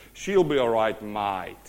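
demonstrated Australian accent, falling away on "mate"; laid-back Aussie delivery. Shown as a demonstration. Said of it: "let me say it in the Aussie accent".

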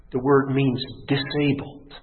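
normal talking voice

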